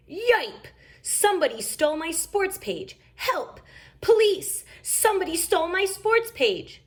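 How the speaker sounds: high, frantic cries for help; hammed-up alarm over the paper; mock panic. A narrator's reading. Papa Bear in the play crying for police, mock panic. Papa finds sports page stolen.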